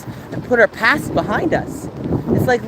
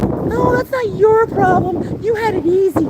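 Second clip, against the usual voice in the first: high, sneering mock voice, much higher; mocking dismissive people; 13 semitones higher than usual. Streamer mimicking people who say she had it easy, high sneer. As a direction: mimic the know-it-alls sneeringly.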